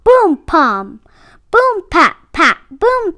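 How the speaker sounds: ordinary delivery, nothing marked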